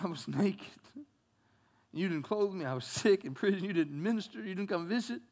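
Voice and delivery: hushed reproach from God; soft, low God voice. Acted.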